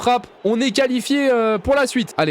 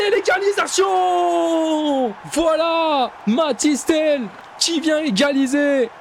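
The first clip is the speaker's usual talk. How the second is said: excited celebration; 5 semitones higher, slower than usual. Real, not acted. Team scores the equaliser.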